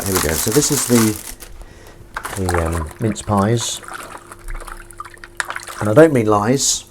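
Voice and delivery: normal talking voice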